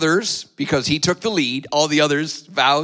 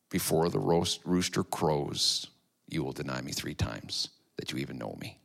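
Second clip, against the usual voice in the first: much softer, lower, hushed recall; remembered words; 12 semitones lower, 14 dB quieter than usual. A narrator's reading. Jesus' words flashing through Peter's mind, hushed. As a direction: let it echo faintly in memory.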